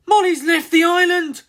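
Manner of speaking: high, loud alarmed cry; panicked cry. Acted. Mother discovering her daughter gone, panicked cry. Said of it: "cried Ginger".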